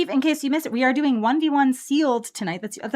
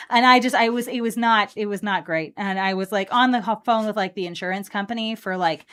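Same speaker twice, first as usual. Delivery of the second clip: usual speaking voice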